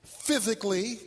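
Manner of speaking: soft teaching read. Acted.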